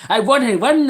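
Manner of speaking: put-on loud, high-pitched thick Cavan accent. Imaginary shop customer, loud thick Cavan accent. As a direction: lay on the thickest Cavan brogue. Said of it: "the thickest Cavan accent ever".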